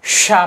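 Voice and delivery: "शा" with a long hushing sh before the vowel; sh sound. Shown as a demonstration. Teacher contrasting two letters, "शा" with clear sh. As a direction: stretch the sh in "शा", unlike a hissed s. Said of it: "इसको सा पढ़ेंगे और इसको".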